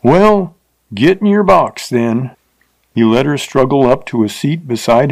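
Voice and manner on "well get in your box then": higher, leering coarse frontier voice; leering rider. A narrator's reading. Rider leers at the stuck-up teacher, coarse voice. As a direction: sneer it like a crude drifter.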